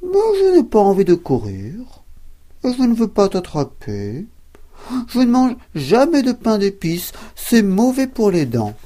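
high, loud falsely friendly fox; cunning fox reassuring the cookie; sly fox. Acted. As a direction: sound innocent to trick him. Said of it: "Le rusé renard répondit".

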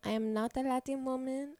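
badly faked Spanish accent; hammed-up, unconvincing Spanish accent; terrible Spanish accent. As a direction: put on a clumsy Spanish accent. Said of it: "That's a terrible Spanish accent".

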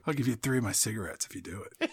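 fast, hushed, low aside; quick mock-secret offer under the breath; conspiratorial bribe. Bribing a student to do it, hushed mock aside. Acted bribe continuing teacher bit.

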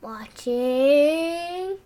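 a hint of a spooky wall creature; lightly voiced creepy whisper-monster. Monster in the wall taunting the girl, lightly spooky. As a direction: say it like a lurking monster, half play. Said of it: "He's going".

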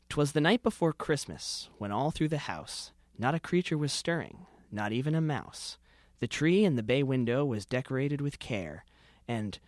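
rhythmic storytelling read of a comic Christmas poem; comic holiday poem recitation. Acted. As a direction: read it aloud with a mock-festive lilt. Reads his Christmas parody poem.

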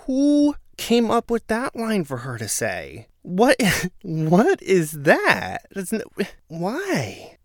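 high, slow, laughing disbelief; baffled, can't believe the line; stunned, half-laughing what; incredulous disbelief. Real, not acted.

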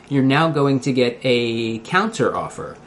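ordinary talk

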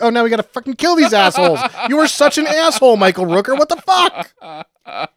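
high, loud hammed-up fury; cartoon villain fury; mock outrage. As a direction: rant at the traitor.

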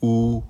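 OU held high and perfectly level; first tone on OU. Shown as a demonstration. Teacher showing learners Mandarin OU, high level tone. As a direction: say OU long, high and even. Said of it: "Je répète".

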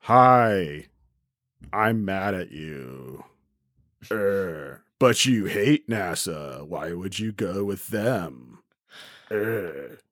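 slow, low, wooden bad-actor voice; lightly hammed-up imitation of a terrible line read; mocking bad acting. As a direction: drag it out like a stiff angsty teen actor. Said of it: "the first talking line on there is like".

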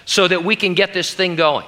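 a touch of excited impatience; Peter. Acted. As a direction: urge everyone on, keen to get started. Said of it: "And so Peter's like".

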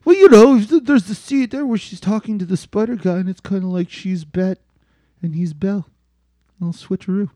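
lightly put-on voice of someone explaining himself. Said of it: "Yeah, he's like".

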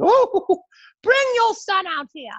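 put-on a tipsy brunch lady calling for the boy; mom's lady friends. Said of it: "my mom's friends would".

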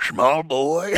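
put-on Southern old man, laughing through it; Southern drawl with a chuckle. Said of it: "It's weird when it's that voice".

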